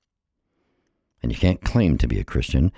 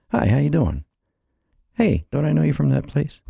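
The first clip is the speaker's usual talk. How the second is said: bright friendly greeting; 13 semitones higher than usual. Pretend. Acting a friendly greeting to strangers.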